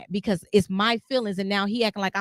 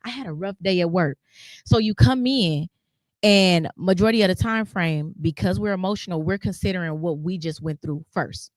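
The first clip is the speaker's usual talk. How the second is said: usual speaking voice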